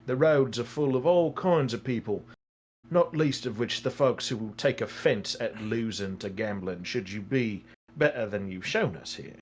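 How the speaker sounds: low, measured voice of counsel; concerned advisor Arno. Acted. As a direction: gently caution a foolish gambler.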